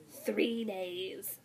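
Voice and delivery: a hint of a troll's threat; warning. A narrator's reading. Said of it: "Olaf warned".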